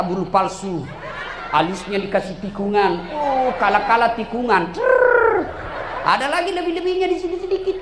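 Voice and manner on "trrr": trilled sound effect. Acted. Mimicking the eyebrow curve.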